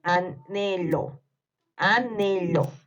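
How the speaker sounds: slow, stretched "Anhelo"; repeated dictation. Shown as a demonstration. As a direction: spell out "Anhelo" by syllables for young writers. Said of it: "Vuelvo a repetir". (dictado lento, repitiendo "Anhelo")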